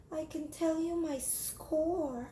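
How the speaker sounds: high, soft and slow; timid little girl. Acted. Jamaica answering her teacher, timid. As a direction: answer shyly.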